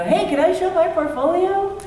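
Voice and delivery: high, bright-eyed mock hopeful ask; a mock eager newcomer, pitched high; eager young artist. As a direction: pitch it like a nervous kid at the door. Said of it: "and go".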